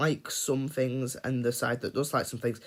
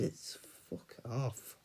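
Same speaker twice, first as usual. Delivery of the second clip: whispered aside; 2 semitones lower, 12 dB quieter, slower than usual. Real, not acted. Aside to himself about cancel culture.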